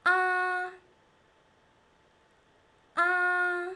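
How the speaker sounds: "Ah" long, high and level; long, loud "Ah" on one high note; first tone. Shown as a demonstration. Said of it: "make it long and high".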